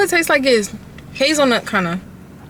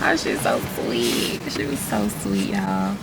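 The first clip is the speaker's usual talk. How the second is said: hushed, breathy whisper to the camera; whispered aside; 5 semitones lower, 9 dB quieter than usual. Real, not acted. Whispering to viewers about the sweet barista. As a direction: whisper it so the barista can't hear.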